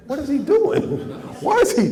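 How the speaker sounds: high, loud, laughing what is he doing; laughing mock confusion. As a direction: imitate his baffled look through laughter. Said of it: "he looked at me like".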